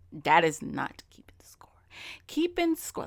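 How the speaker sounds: hushed, breathy whisper of the same line. Shown as a demonstration. Repeating his point to listeners in a whisper. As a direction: drop to a secret whisper. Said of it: "Gotta put it in my whispering voice".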